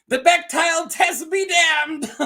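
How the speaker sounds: high, loud, slow declaration; mock proclamation. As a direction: proclaim it grandly, then break into laughter. Mock-triumphant aside, breaking into laughter.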